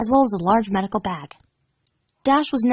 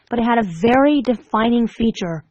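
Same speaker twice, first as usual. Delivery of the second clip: ordinary delivery, nothing marked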